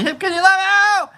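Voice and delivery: excited shout-out. Real, not acted.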